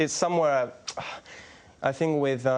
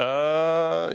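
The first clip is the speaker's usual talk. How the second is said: Murray's uh yeah; 4 semitones higher than usual. Retold or imitated. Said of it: "Andy always comes out with the".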